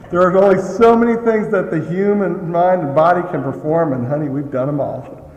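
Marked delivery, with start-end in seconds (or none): none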